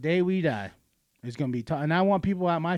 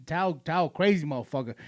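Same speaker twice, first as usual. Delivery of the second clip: normal talking voice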